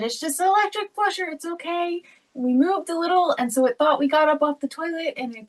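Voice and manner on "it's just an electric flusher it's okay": higher, gentle reassuring mom voice; soothing. Retold or imitated. Mom reassuring her scared son about the flusher, higher and gentle. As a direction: coax him calm, sweetly high. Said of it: "And I said".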